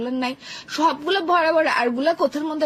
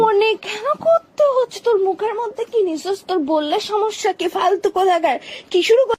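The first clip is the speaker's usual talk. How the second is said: shrill angry shriek; 7 semitones higher, 5 dB louder than usual. Acted. Girlfriend shrieking at boyfriend over the phone, high and loud.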